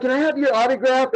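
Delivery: high-pitched eager autograph seeker; starstruck fan. Retold or imitated. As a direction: ask like an excited fan, voice lifted high. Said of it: "And she's like".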